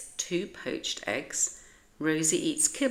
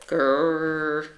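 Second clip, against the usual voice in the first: louder growl in a changed voice; dog growl; 6 dB louder than usual. A narrator's reading. Maurice shakes Fifi like a bunny, growling.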